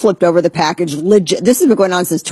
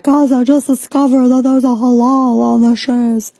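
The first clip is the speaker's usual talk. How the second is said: imitating a woman; 7 semitones higher than usual. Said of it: "some chick was like".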